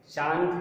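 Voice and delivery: चांद with a full n after the vowel; full n sound. Shown as a demonstration. Teacher showing what not to say, चांद with full n. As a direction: pronounce the n of चांद as a separate consonant. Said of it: "नहीं होता है".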